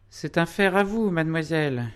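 very high, loud society-lady voice; high, showy politeness; affected lady. A narrator's reading. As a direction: address the young lady in a put-on high voice. Madame Crémière's affected politeness to Ursule.

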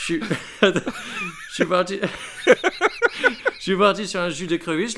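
laughing impression, posh and Creole; giggling through a posh Creole voice; posh Creole accent. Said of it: "tu fais trop l'entier".

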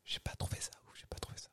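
whispering. Real, not acted. Confessing a blunt opinion.

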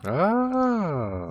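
a high, drawn-out ah of sudden recognition; surprised ah. Real, not acted. Friend suddenly recognizing the console, surprised ah. Realizes which console is meant.

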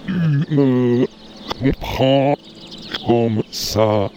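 drawn-out slow speech. Acted. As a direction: stretch every word, very slow. Slow talk continues.

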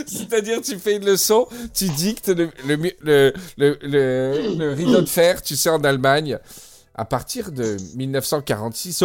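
ordinary delivery

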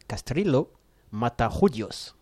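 "Castrillo" then a pause, "Matajudios" very slow in a Spanish accent; Spanish accent, repeated. Shown as a demonstration. Host repeats the name for co-hosts, slow Spanish accent. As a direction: spell it out by ear in Spanish, word by word. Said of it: "Je répète".

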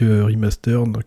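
ordinary delivery

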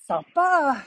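high sarcastic sneer. Real, not acted. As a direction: ironic bitter remark. Sarcastic comment after insult.

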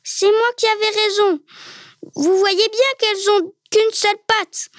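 high, triumphant insistence; excited cry. A narrator's reading. Cook shows his master the one-legged cranes, excited cry. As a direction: exclaim it high, eager to be proved right. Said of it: "cria-t-il tout de suite".

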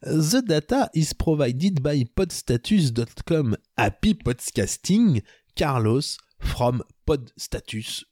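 put-on slow English read in a thick French accent; clumsy French-accented English, drawn out. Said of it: "vous pardonnerez, euh, mon accent".

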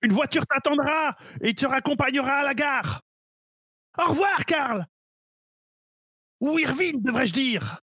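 high, cold villain reveal; sinister villain. Acted. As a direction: unmask him with icy glee. Villain reveals the hero's true name.

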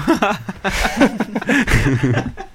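helpless high laughter; laughing fit. Real, not acted. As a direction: burst out laughing, can't stop. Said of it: "un deuxième mort de rire".

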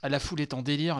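ordinary talk

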